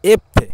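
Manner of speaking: এফে called out high and loud; teacher belts out এফে; emphatic demo. Shown as a demonstration. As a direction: punch এফে for the learners. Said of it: "ইতালীয় ভাষায় হবে এফে".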